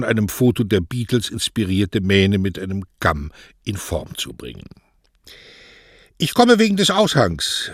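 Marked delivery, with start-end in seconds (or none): 6.2-7.7 high, beaming announcement; cheerful with a smile. A narrator's reading. Young Mario announcing himself to the postmaster, smiling. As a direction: smile through the words, much higher. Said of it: "verkündete er mit einem Lächeln".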